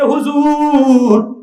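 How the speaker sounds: very slow, high, stretched call; drawn-out address. Retold or imitated. Businessman addresses the cleric, long drawn-out call.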